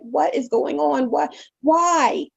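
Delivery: high, desperate cries of confusion; retold yelling. Daughter yelling in her room after the fight, high and desperate. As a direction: yell it out, lost and hurt. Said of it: "I was yelling".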